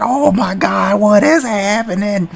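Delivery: normal talking voice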